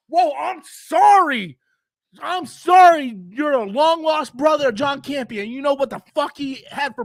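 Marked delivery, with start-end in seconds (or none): 0.1-5.5 slow, loud, high mock apology; sarcastic apology. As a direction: drag out the sorry to mock them.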